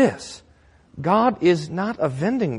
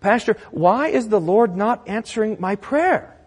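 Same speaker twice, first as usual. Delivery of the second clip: high, insistent, piling-up complaint; anguished churchgoer; 9 semitones higher, 4 dB louder than usual. Acted. Churchgoer asking pastor about unanswered prayer, pleading complaint. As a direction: plead upward, one trouble after another. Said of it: "I get this question from you".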